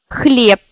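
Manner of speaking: "Хлеб" said as "khlep"; "Хлеб" with the final Б said as P; Russian final devoicing. Shown as a demonstration. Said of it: "Si je prononce vite ces mots".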